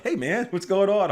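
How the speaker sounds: high, bright imitation of a coworker's hello; lightly voiced casual greeting; cheery mock greeting. Darren greeting cheerfully in dark.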